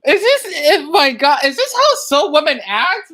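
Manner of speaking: loud, incredulous outburst while laughing; shouting in disbelief. Real, not acted. Reacting to the clip, loud disbelieving shout. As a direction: yell it out in shock.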